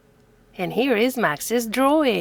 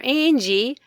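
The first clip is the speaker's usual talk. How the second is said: ordinary delivery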